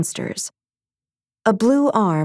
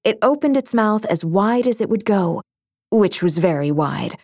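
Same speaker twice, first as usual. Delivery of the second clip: ordinary delivery, nothing marked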